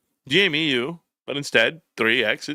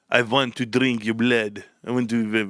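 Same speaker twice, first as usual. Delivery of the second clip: put-on botched Russian accent; 5 semitones lower than usual. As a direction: attempt a thick Russian accent and fumble it. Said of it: "I don't know Russian accents".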